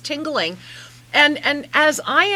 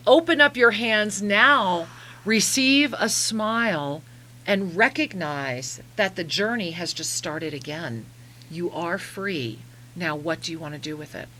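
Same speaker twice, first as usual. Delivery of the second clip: channeled message; 5 semitones lower, 4 dB quieter than usual. Acted.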